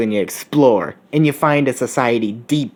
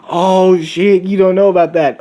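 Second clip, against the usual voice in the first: higher and louder, caught off guard; surprised outburst; 7 semitones higher, 4 dB louder than usual. Acted. He realizes she's never heard of it, surprised outburst. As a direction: blurt it out in sudden surprise. He's surprised she doesn't know.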